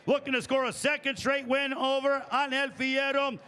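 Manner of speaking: booming ring announcer, building to a shout; lucha libre announcer. Acted. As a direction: drag the name out big and loud over the crowd. Ring announcer introduces the fighter.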